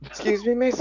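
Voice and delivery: high, loud Scouse accent drifting into Welsh; Scouse accent attempt. Shown as a demonstration. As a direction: put on a hammed-up, lilting Scouse accent. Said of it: "Scouse accent".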